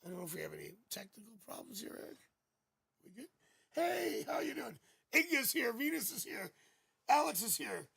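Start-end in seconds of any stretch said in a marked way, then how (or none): 3.7-7.9 high, loud, bubbly hellos to the viewers; excited greeting. Real, not acted. Psychic greeting viewers arriving in the chat, excited. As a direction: call out the names with delight.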